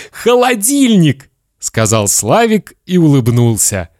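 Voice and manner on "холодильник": put-on very high, happy child voice; boy's proud answer. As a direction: announce the prize like a little boy. Boy proudly names the prize.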